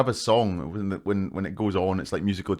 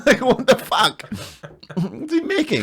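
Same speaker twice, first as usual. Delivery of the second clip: laughing disbelief; 13 semitones higher, slower than usual. Real, not acted.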